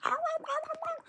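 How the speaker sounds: very high-pitched dog yelps; high repeated "ah" like a frantic little dog; imitated screaming bark. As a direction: mimic a dog barking in curdling screams. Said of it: "sounds like s-, curdling screams".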